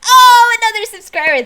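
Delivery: high, loud, thrilled "Oh"; delighted surprise. Real, not acted. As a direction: gasp with joy at the new subscriber. Another alert interrupts.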